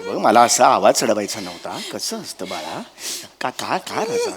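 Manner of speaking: low, soft backtracking; calm a crying person gently, voice dropped; hushed, apologetic coaxing; soothing. Acted.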